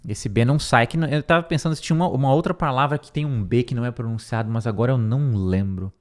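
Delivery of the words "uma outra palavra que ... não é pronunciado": usual speaking voice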